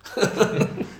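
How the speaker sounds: short breathy chuckle; laugh. Real, not acted. Coach laughing after mocking long-ball football, chuckling. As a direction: laugh at your own jibe. Laughs at his own jibe.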